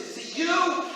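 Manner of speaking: high surprised Jack. Acted.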